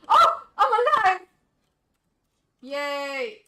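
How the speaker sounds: high, loud burst of relief; shocked elation. Real, not acted. Player finds they are alive, shouting elated.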